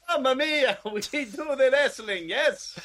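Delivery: put-on hammy Italian accent, slow; mock Italian, laughing through it. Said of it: "I'm not even gonna try and do an Italian accent".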